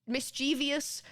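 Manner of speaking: "Mischievous" drawn out as mis-CHEE-vee-us; slow "Mischievous" in four syllables, stress on CHEE; common mispronunciation. Shown as a demonstration. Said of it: "sounds better".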